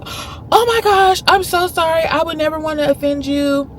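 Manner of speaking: very high, slow mock gush; imitating her gushing apology. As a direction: stretch out her horrified sorry. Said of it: "And she was like".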